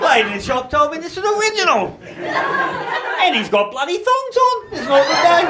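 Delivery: high, loud, laughing mockery of the other bloke's outfit; mocking through laughter. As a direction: squeal it out while cracking up. Truckie mocking another guy's outfit.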